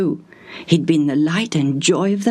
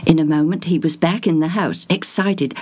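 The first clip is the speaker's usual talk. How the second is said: plain reading voice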